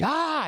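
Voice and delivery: high-pitched imitated scream; mock scream. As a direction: imitate a startled woman's scream. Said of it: "and she screamed".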